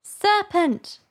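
high but restrained accusation; subdued screech. A narrator's reading. Pigeon repeating its charge to Alice, subdued. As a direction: insist in a high, held-back bird voice. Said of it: "but in a more subdued tone".

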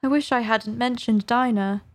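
ordinary delivery, nothing marked

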